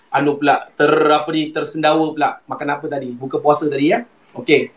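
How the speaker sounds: ordinary talk, nothing marked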